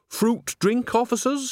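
high, sugary offer; butter them up innocently; sweet innocent old woman. A narrator's reading. Nanny sweetly offers drink.

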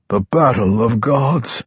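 slow, understated disbelief; incredulous. A narrator's reading. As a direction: say it slowly, hardly believing it. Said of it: "his voice full of incredulity".

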